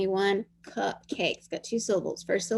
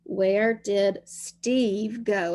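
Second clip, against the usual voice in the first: slow dictation; much slower than usual. Shown as a demonstration. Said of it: "So we have four words".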